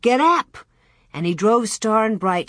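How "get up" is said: high, sharp call to animals; shouted command. A narrator's reading. Almanzo starts his calves with the whip, shouted command. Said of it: "cracked his whip and shouted".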